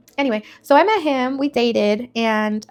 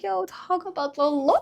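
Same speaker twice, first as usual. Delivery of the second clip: high, amazed "yo"; pitched-up disbelief at the blessing; excited retelling of amazement; 8 semitones higher than usual. Retold or imitated. Amazed reaction to the offer.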